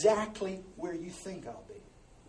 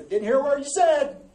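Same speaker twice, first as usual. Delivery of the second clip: loud, raised-pitch holler; mock deaf shout; 8 semitones higher, 9 dB louder than usual. Husband with remote telling wife, mock deaf holler. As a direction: holler it as if hard of hearing, playfully.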